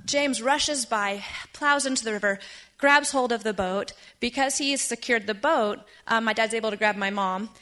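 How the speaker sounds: ordinary talk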